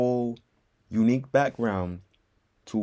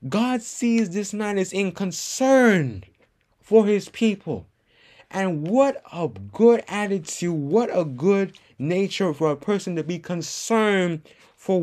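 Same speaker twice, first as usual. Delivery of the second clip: earnest preaching; 5 semitones higher, 4 dB louder than usual. Real, not acted. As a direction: press each phrase home. Preaching at raised pitch.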